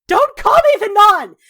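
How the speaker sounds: high, very loud outburst; enraged yell; furious shout. Acted. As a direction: explode at being called that. Acted explosion at the nickname.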